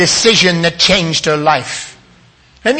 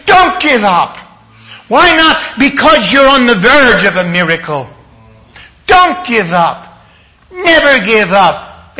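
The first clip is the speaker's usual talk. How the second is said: rousing preacher's urging; forceful exhortation; 3 semitones higher, 4 dB louder than usual. Real, not acted. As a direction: urge them on with full conviction. Urging listeners not to give up.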